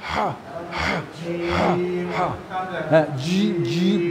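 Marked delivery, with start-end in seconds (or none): 0.0-2.3 হা drawn out very slowly and softly; হা ধীরে নরম নিঃশ্বাসে; breathy ha. Shown as a demonstration. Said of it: "বলো, হয় নাই".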